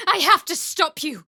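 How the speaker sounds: loud, high cry to stop an enemy; urgent shout. Acted. Warrior shouting to stop a foe, urgent cry. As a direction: shout it out to halt them.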